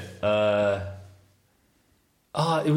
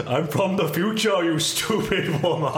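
higher, slow, laughing mock outrage; buzzer quote voice; 6 semitones higher, slower than usual. [name] finally remembers his buzzer line and performs it. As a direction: ham up the insult through laughter. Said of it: "I forgot my buzzer".

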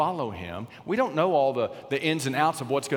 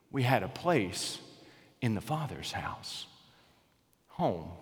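slow, soft and low, almost a whisper; tender hush; 4 semitones lower, 6 dB quieter, slower than usual. Real, not acted. Preacher softly assuring the church of its place, quiet and tender. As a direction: ease the congregation down gently.

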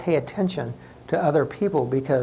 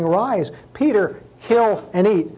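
slow, raised pitch, commanding scripture quote; voice from heaven; 6 semitones higher, 3 dB louder, slower than usual. Acted. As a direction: lightly act out a heavenly command, word by slow word. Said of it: "there came a voice to me saying".

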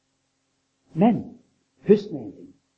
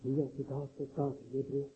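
hushed prayer; 9 dB quieter than usual. Acted. The people pray for daily bread, hushed and soft. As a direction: pray it quietly, almost to yourself. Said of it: "Og de roper".

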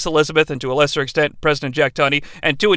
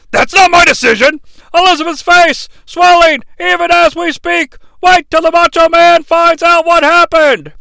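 commentator; 9 semitones higher, 4 dB louder than usual. Retold or imitated. Imitating second commentator.

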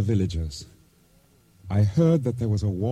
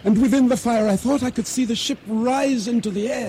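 higher, urgent storytelling as the wave hits; breathless climax; 7 semitones higher than usual. Acted. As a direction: build the panic of being swept under. Narrator's climax of explosion and drowning.

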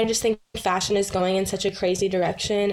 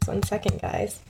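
holding back a sneeze; talking right before a sneeze; about to sneeze; 4 dB quieter than usual. Real, not acted. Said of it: "wait, I have to sneeze".

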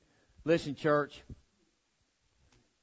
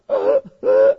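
high, loud helpless laughing fit; wheezing laugh; 15 dB louder than usual. Retold or imitated. Dad on his knees laughing, high wheezing laugh. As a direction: laugh till you can't breathe. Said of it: "he's like".

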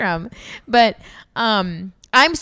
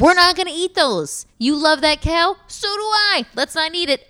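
put-on higher, louder, sweet parent voice explaining to a toddler; mock parent to child; 7 semitones higher, 3 dB louder than usual. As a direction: coax the kid in a high sing-song. Parent talking to her child.

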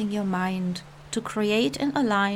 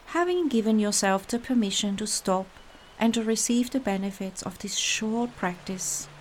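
normal talking voice

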